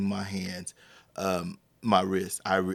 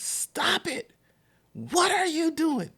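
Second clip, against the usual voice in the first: acted-out shriek at usual volume; retold yell; slower than usual. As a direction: imitate an outraged mom yelling, high. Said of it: "at the top of her lungs".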